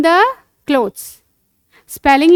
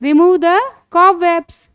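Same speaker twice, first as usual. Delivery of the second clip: slow, clear, drawn-out English model phrase; slow teaching model; 4 semitones higher, much slower than usual. Shown as a demonstration. Teacher slowly modelling phrase for a child. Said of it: "यहाँ पर क्या बोलोगे?".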